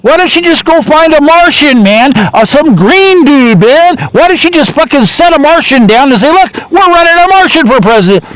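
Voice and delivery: very high-pitched incredulous rant; hammed-up fury at an absurd choice; imitated furious voter. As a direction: rant in acted outrage, pitch through the roof. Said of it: "People are furious".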